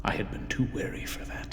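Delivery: secretive whisper, almost no voice. Acted. Murderer whispering his own cleverness to the listener. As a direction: breathe it like a guilty secret.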